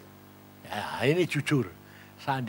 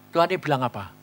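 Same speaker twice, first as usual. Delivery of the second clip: ordinary talk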